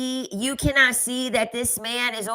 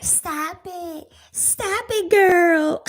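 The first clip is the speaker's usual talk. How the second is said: high-pitched, campy put-on voice; mock sassy man; 9 semitones higher, much slower than usual. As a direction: imitate a sassy man in a playful squeal.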